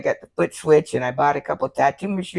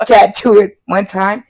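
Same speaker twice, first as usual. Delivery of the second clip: normal talking voice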